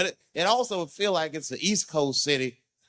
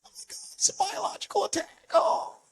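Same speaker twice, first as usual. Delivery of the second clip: high breathy panic; mock hysterical fear; panicked passenger. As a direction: shriek like it's a gas attack. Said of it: "everybody freaks out".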